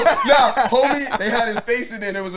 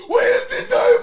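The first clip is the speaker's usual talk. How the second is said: high, loud mock cry of where's the diamond; imitating a desperate actor's cry; high mock movie shout; 4 dB louder than usual. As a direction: squeal it like the movie scene. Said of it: "repeating the movie line".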